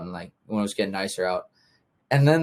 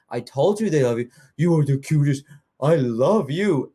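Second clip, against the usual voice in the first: cooing to dog; 5 semitones higher, 4 dB louder than usual. Retold or imitated. Neighbor gushes baby talk to her dog. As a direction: gush over the dog lovingly. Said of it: "talking to the dog".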